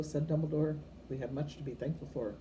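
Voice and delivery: ordinary delivery, nothing marked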